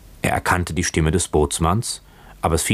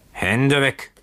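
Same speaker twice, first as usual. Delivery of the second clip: roared warning; 9 semitones higher than usual. Acted. Drunken boatswain warding off Keawe, roared warning. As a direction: warn him off with a raised, higher voice. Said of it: "brüllte der Bootsmann ihn an".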